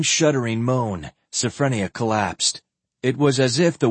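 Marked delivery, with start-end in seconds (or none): none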